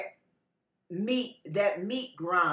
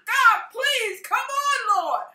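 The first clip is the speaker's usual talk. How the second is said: high, urgent imploring; pleading prayer; 13 semitones higher, 6 dB louder, slower than usual. Acted. Believer begging God round the clock, urgent pleading. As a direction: beg heaven for an answer.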